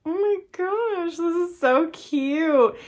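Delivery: high, slow, drawn-out squeal of delight; oh my gosh, so cute, stretched high; delighted gush. Real, not acted. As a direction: gush over it like it's the cutest thing ever. Delighted at book design.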